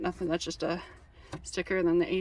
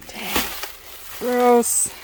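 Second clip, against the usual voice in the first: disgusted; 4 dB louder than usual. Real, not acted. Stuff left in cubby.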